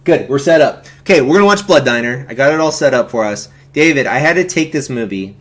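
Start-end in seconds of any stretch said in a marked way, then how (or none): none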